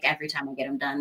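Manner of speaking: normal talking voice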